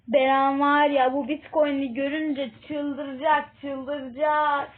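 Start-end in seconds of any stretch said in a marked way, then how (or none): none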